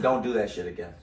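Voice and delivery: low-key, quiet warning; calm, cold warning under the breath; real calm. Retold or imitated. To the opponent on the court, a real calm quiet warning. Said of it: "Real calm, just like that".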